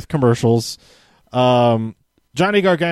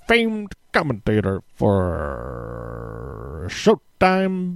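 slow, stretched boxing announcer call with big pitch swings; hammed-up ringside introduction; big announcer intro; slower than usual. Shown as a demonstration. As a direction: boom it out like introducing a fighter. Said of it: "I say it like that".

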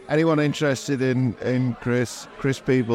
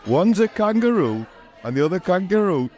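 Geordie punchline, higher and louder; Geordie accent; 9 semitones higher, 4 dB louder than usual. Acted. Host delivers the punchline to co-host, in a Geordie accent. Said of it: "in my strong Geordie accent".

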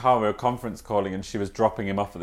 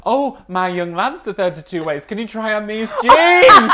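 high voice rising to a loud plea; hammed-up high request, building louder; eager stranger; 9 semitones higher, 7 dB louder than usual. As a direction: imitate an eager mother buttonholing a stranger. Voices eager woman asking Dad.

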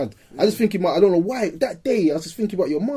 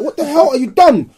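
higher, louder furious yell; angry shout; 8 semitones higher, 5 dB louder than usual. Retold or imitated. Friend yelling at the youth who hit Stacks, angry shout. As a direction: yell it at the attacker. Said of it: "I'm like".

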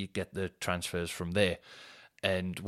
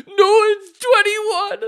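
squeaky, much higher, louder slow wail, laughing; mock whiny passenger; 13 dB louder, much slower than usual. Mock wail, passenger insisting on his seat row. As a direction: wail it like a wounded child.

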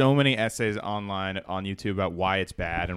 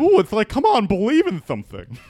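much higher, loud lisping guy; 11 semitones higher, 6 dB louder than usual. Retold or imitated. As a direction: whine it out with a lisp. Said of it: "like, a guy with a lisp says nihilists".

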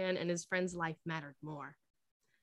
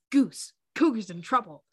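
urgent worried call, louder; 3 semitones higher, 9 dB louder than usual. A narrator's reading. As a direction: alarm in the voice as he calls out. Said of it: "Maverick worriedly said".

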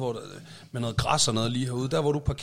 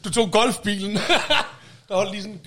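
higher-pitched words through laughter; laughing; 8 semitones higher than usual. Real, not acted. Co-host teases friend about his huge garden, laughing. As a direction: tease while cracking up. Co-host cracks up at own joke.

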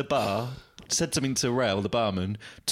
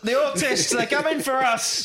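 much higher, hammed-up doom; mock paranoid warning; 13 semitones higher, 4 dB louder than usual. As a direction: play it like a paranoid prophet. Echoing the veteran's paranoid line.

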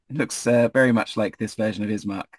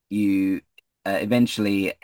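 ordinary talk

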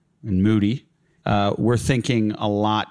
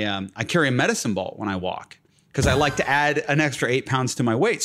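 normal talking voice

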